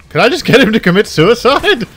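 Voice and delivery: high, loud question tipping into giggles; gleeful laughing. Real, not acted. Gamer finding a wicked hack, gleeful laughing. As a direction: ask it giddily, then crack up. Amused by game hacking option.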